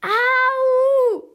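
a sudden piercing cry of someone hurt; shocking scream of pain from upstairs. A narrator's reading. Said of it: "schmerzerfüllter Schrei aus dem Obergeschoss".

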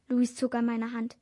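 plain reading voice

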